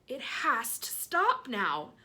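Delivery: loud, very slow yell; slow loud aunt yell. Acted. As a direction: drag it out loudly. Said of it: "Aunt Spiker yelled".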